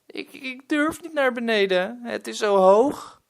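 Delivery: put-on high-pitched frightened little boy, stammering; a boy too afraid to climb down; scared child. Boy stuck beside the chimney pleads, high frightened voice.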